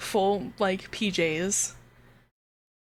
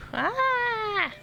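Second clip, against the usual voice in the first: surprised; 13 semitones higher than usual. Real, not acted. Startled by a game event.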